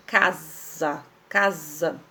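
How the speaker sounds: "casa" with one S voiced as Z; low, deliberate "casa" with a buzzing Z sound; single S sound. Shown as a demonstration. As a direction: say "casa" as "ca-za", no doubled S. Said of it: "eu não pronuncio dois S's".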